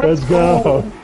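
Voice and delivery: loud laughing victory shout; laughing cheer. Real, not acted. Winning the match.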